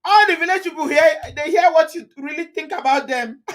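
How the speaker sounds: high and loud, laughing through the words; teasing Eunice about her village, laughing. Real, not acted. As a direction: talk while cracking up. Laughing at comment.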